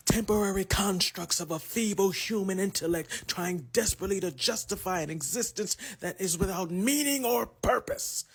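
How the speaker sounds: menacing machine villain monologue, building from halting questions to a loud, high outburst; theatrical, mounting frustration at a stubborn foe; frustrated Mister Smith. Acted. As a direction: deliver a villain's exasperated interrogation, pitch and volume climbing. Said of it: "kinda shows his frustration".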